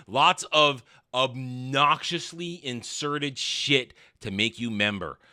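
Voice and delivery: usual speaking voice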